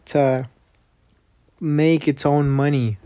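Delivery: ordinary talk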